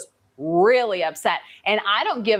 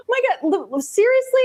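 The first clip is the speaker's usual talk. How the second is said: laughing disbelief; 11 semitones higher, faster than usual. Real, not acted. Disbelief at the customer's behaviour.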